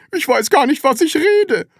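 high, loud bewilderment; flustered landlady's high voice; confused. A narrator's reading. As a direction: blurt it out, baffled by your own words. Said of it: "lauschte verwirrt ihren eigenen Worten".

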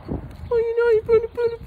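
very high squeaky imitation; her voice. Friend arguing with him, very high mock voice. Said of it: "But she was saying".

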